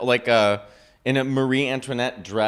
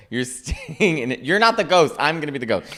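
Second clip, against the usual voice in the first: ordinary delivery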